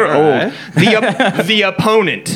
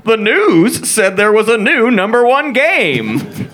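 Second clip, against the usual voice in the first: put-on child's announcement; 7 semitones higher, slower than usual. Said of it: "and announced to his sister".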